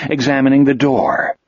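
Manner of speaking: ordinary delivery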